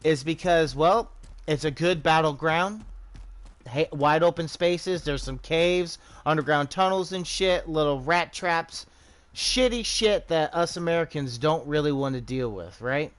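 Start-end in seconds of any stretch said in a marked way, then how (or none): none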